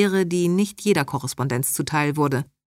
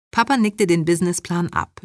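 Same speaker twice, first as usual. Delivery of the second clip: plain reading voice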